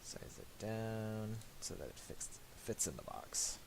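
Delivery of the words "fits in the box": usual speaking voice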